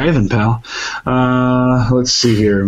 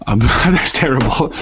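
ordinary delivery

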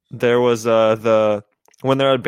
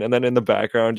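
usual speaking voice

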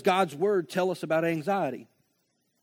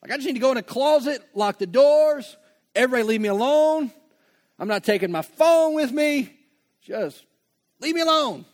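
frantic venting; 7 semitones higher, 7 dB louder, faster than usual. Pretend. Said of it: "He says, Don't be worried".

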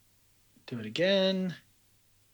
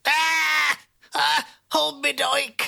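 high panicked shrieks; 11 semitones higher, slower than usual. Acted. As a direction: shriek and cling in fright. Character panics at monster cage.

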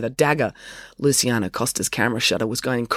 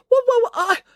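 very high, flustered stammer; spluttering; 5 dB louder than usual. A narrator's reading. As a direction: splutter as if caught out. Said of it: "Matilda spluttered a bit".

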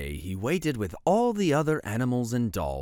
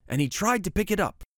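usual speaking voice

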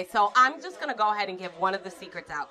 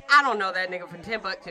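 ordinary delivery

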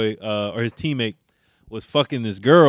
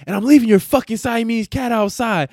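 high, louder threat; imitating angry girlfriend; 11 semitones higher, 5 dB louder than usual. Girlfriend threatening the cheating boyfriend, high and loud. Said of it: "she was like".

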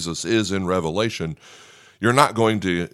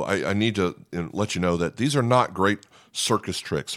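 ordinary delivery

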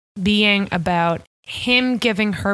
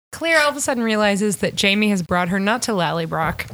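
normal talking voice

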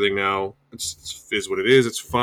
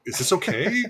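high nervous question; 13 semitones higher than usual. Acted.

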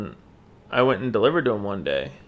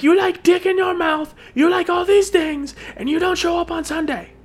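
high, loud mock-furious yell with broken English; angry shouting boss; 4 dB louder than usual. Manager yelling at the teen driver for skipping Sunday, mock furious shout. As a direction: bellow it like an irate boss.

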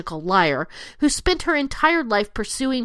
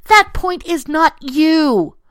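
louder and slower, each word hammered; slow emphatic; 5 semitones higher, 6 dB louder, slower than usual. Real, not acted. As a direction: spell it out word by word. Ranting at liberals, emphatic.

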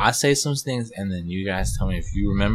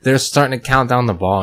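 ordinary talk, nothing marked

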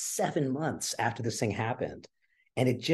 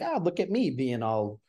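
lightly hammed-up self-satisfied hipster; a hint of preening cool guy; smug cool guy; 5 semitones higher than usual. Guest lightly mocking his past self as a cool guy. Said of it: "cool guy going to see theater".

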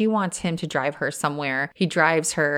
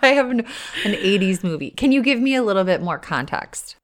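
usual speaking voice

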